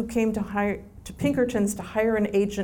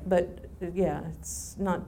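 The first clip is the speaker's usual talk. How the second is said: ordinary talk, nothing marked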